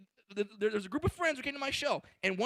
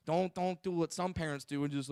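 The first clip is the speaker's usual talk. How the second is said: normal talking voice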